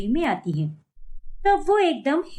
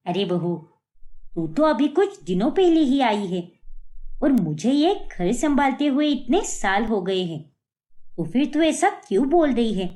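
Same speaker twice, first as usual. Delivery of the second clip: put-on lower, older mother-in-law voice; mother-in-law asking her daughter-in-law about the market, in an older, lower voice; 4 semitones lower than usual. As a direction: speak as the puzzled elderly mother-in-law.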